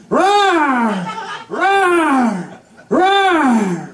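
three quick mock lion roars. Comic lion roaring as he promises to hunt. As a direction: roar it out like a prowling lion. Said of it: "And he would be like".

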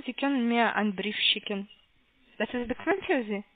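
ordinary delivery

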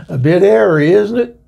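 put-on a quip in an English accent; an Englishman commenting on the wind in an English accent. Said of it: "that English accent".

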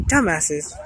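soft muttered repeat; quiet. Real, not acted. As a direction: mutter it under your breath. Muttered repeat after laugh.

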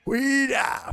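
very high, stretched mock cry; put-on high-pitched call; Furia. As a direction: imitate a housemate's showy holler. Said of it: "más o menos igual como habla ella".

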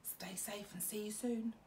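soft whisper; whispered goodbye. Real, not acted. Teacher whispering goodbye to her class. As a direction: whisper a fond farewell.

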